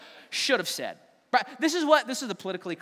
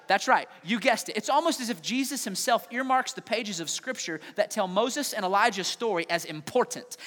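ordinary talk

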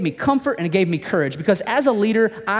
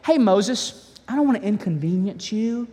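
mock polite God; 3 semitones higher than usual. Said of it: "that's not how God operates".

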